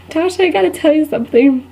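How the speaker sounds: high, mock-anguished lead-up; hammed-up penitent about to own up; guilty confession. Guilty person confessing to the student, high pleading voice. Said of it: "they start confessing all their sins".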